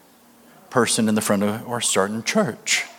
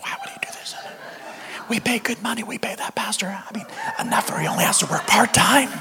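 hushed, higher mock gossip whisper; scandalized whispering; 8 semitones higher, 9 dB quieter than usual. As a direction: whisper like outraged churchgoers behind the preacher's back. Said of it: "And people are whispering".

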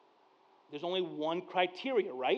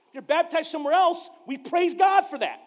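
preaching lift; 10 semitones higher, 6 dB louder than usual. Real, not acted. Preaching emphasis.

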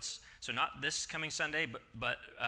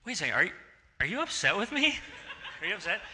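higher, louder, disbelieving questions; incredulous; 7 semitones higher, 4 dB louder, faster than usual. Retold or imitated. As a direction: catch on with alarm. Husband realizing she is upset.

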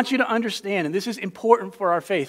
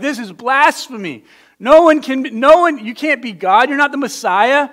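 higher and louder, appalled cry of blasphemy; outraged high priest; 6 semitones higher, 6 dB louder than usual. Retold or imitated. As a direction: cry out like a priest tearing his robes. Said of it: "he goes crazy 'cause he's like".

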